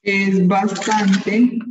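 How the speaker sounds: each word drawn out slowly for the class; teacher dictating slowly; slow dictation. Shown as a demonstration. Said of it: "Escuche bien".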